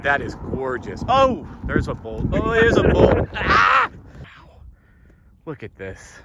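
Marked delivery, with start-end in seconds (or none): 1.1-2.3 rising excitement at a bolt; a bit higher, caught by surprise; first glimpse of lightning, eager; excited. Real, not acted. 2.3-3.3 much higher, louder thrilled cry; excited shout. Real, not acted. Spots lightning bolt. 3.3-3.9 very high, loud laughing squeal; thrilled laughing yelp. Real, not acted. Thrilled by lightning strike.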